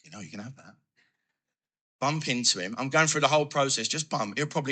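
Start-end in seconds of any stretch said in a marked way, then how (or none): 0.1-0.8 very soft, low aside; hushed voice of the brain making a suggestion; tempting inner voice. Acted. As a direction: murmur it like a sly thought. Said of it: "So my brain goes".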